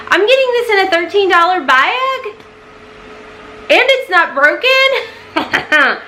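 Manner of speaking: high, slow amazed disbelief; delighted disbelief over a cheap palette; surprised delight. Real, not acted. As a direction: gasp at the bargain.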